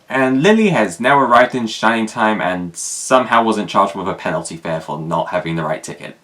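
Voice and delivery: ordinary talk, nothing marked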